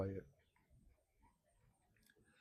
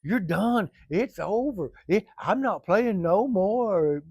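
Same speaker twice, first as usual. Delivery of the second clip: mock friend quitting; 7 semitones higher, 7 dB louder, much slower than usual. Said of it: "I said".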